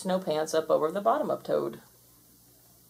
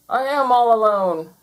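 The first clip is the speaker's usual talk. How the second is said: loud, raised scream; 4 semitones higher, 9 dB louder than usual. A narrator's reading. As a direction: cry it out loudly in alarm. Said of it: "screamed Toad".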